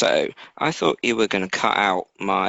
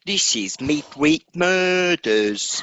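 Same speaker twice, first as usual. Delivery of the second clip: slow, higher-pitched hammed-up English accent; cartoonish English show host; exaggerated English accent; 6 semitones higher, slower than usual. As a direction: announce it in a broad mock-English accent. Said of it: "with your best English accent".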